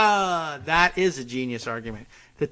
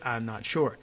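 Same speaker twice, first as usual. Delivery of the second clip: ordinary talk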